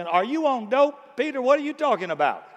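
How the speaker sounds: incredulous. Retold or imitated. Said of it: "I'm in the boat thinking".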